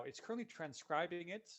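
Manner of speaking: normal talking voice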